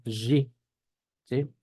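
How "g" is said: G said as a buzzing "zhi"; voiced zh pronunciation. Shown as a demonstration. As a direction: say G like French "j" plus ee. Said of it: "Voy a ponerlo así".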